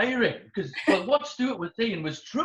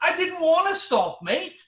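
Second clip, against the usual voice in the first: pedestrian excuse; 9 semitones higher, 4 dB louder than usual. Retold or imitated. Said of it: "And then they'll go".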